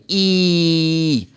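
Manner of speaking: "E" held long, high and loud; loud drawn-out "E" vowel; long E demo. Shown as a demonstration. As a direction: stretch "E" into a sustained eee. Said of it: "Double E has the sound".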